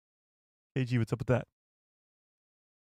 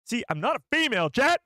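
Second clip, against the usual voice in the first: quick high protest rising to a yell; loud, pitched-up complaint; exasperated outburst; 9 semitones higher, 4 dB louder than usual. Real, not acted. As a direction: burst out at the audience, faster and higher. Protests to chat about failed impression.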